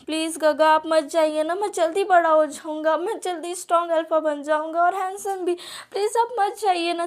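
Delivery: put-on a tearful little boy pleading with his big brother; pleading child. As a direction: beg like a small kid who won't let go. Child begging brother not to leave.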